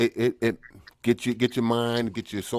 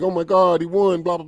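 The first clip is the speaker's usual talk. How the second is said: higher, louder mock gasp of shocked people; 7 semitones higher, 6 dB louder than usual. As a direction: play the stunned crowd, loud and high. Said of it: "people are like".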